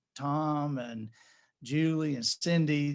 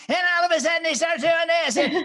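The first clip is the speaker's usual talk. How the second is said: much higher, louder, fast wild preaching burst; crazy Zig; 14 semitones higher, 9 dB louder than usual. Shown as a demonstration. Narrator demonstrating crazy Zig for the son, fast and loud. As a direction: suddenly explode into a frantic sermon. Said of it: "the crazy Zig".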